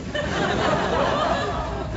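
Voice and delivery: sudden high-pitched giggle. Real, not acted. Comic laughing at the absurd history report. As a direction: burst into a squeaky laugh.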